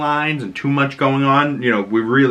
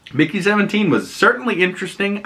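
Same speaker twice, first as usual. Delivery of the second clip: usual speaking voice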